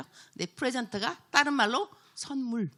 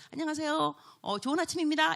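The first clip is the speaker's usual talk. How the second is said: cheerful greeting; 7 semitones higher than usual. Shown as a demonstration. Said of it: "이렇게 말하는 사람하고".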